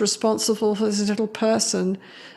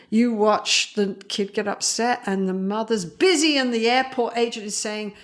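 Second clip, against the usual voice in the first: ordinary talk, nothing marked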